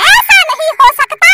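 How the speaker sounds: high witch voice with cackles; mock and defy him as the witch; defiant witch. Witch defying the boy's orders, high cackling voice.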